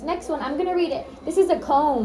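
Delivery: put-on bright, upbeat coaching of little kids; teacher voice to kids. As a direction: cheer them on like a speech therapist. Therapist coaching young kids.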